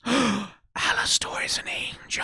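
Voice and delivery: sharp gasp, then a breathless whisper of the reveal. Real, not acted. Reactor realizing Alastor is an angel, in a gasped whisper. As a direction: whisper it like a shocking secret.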